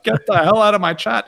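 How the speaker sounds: hammed-up chat-banning shout; mock angry yell. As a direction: bark it like a mod kicking a troll. Retelling throwing someone out.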